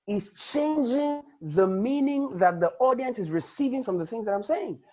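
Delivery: usual speaking voice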